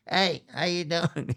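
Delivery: punk rocker greeting. Retold or imitated. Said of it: "like a punk rocker".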